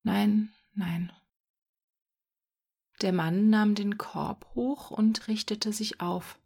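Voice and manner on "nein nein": mildly sorrowful, fading on the repeat; sad. A narrator's reading. Boy gives up on the rabbits, sadly fading. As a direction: let the refusal sink away sadly. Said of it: "sagte Jürgen traurig".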